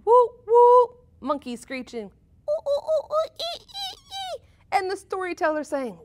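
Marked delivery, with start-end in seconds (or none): 0.0-1.0 imitated horn blasts, high and loud; high loud horn toots. A narrator's reading. 2.5-4.4 very high monkey ooh ooh ee ee; high chattering monkey calls; monkey screeches. A narrator's reading.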